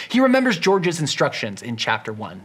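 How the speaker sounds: normal talking voice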